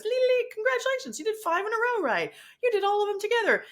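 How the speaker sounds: high, bright cheerful app announcer. Retold or imitated. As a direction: cheer her on like a game app. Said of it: "and it goes".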